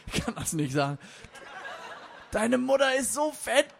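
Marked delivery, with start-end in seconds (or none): 2.3-3.7 high, strained croak, slowing to a loud rasp; wheezing dying joke. Acted. Dying mother joke attempts one last gag, wheezing. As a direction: gasp out the punchline with failing breath. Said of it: "röchelte der Mutterwitz".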